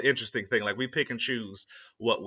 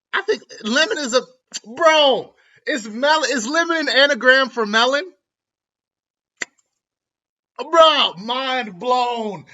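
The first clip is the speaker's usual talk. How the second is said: much higher and louder, shouting in amazement; mind-blown excitement; 9 semitones higher, 9 dB louder, slower than usual. Real, not acted. Vlogger realizing lemon and melon share letters, shouting in amazement. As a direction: yell out the discovery like it changes everything.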